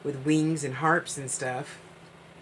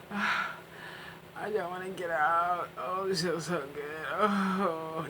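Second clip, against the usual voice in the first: blissful bath sigh; 4 dB quieter, slower than usual. Pretend. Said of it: "you're like".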